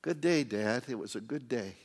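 low, soft, unhurried chat with a father; calm. Acted. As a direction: settle down and speak gently, at ease. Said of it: "he just calmly says".

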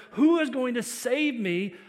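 loud, slow plea for rescue; crying out. Retold or imitated. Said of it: "He cries out and says".

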